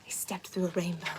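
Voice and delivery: quick, secret whisper. Acted. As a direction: hush it like a magic secret. Magical moment whispered.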